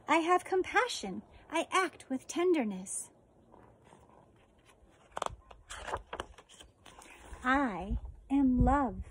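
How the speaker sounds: slow, gentle storybook read; reading to children. Acted. Teacher reading a book on compassion to her fourth graders, gentle read-aloud. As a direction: read it tenderly to the class, pausing often.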